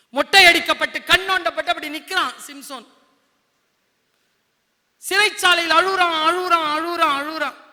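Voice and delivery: loud, high dramatic storytelling; fiery preaching. A narrator's reading. Preacher tells children of Samson's downfall, forcefully.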